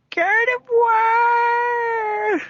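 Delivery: long, high drawn-out Gardevoir cry; Pokemon cry. Acted. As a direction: stretch the name into a high shriek. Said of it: "Gardevoir shrieked in terror".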